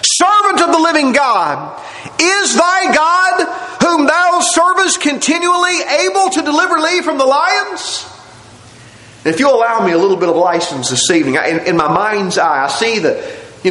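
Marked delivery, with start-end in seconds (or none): 0.0-8.1 lamentable cry. Acted. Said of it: "with a lamentable voice".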